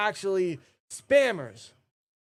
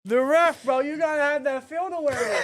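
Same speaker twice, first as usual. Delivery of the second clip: excited laughing shout; 9 semitones higher than usual. Real, not acted. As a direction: yell it out cracking up. Shouting at ref while laughing.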